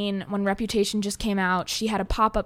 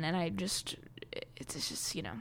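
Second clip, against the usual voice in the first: whispered trail-off; 4 semitones lower, 15 dB quieter than usual. Real, not acted. Trailing off about meeting her.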